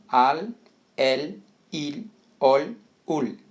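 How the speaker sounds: "Al, el, il, ol, ul" one by one, tongue held on the palate for L; Spanish L drill. Shown as a demonstration. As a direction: drill each syllable apart with a true final L. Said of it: "deixa a língua lá no céu da boca". (treino sílaba a sílaba, L com a língua no céu da boca)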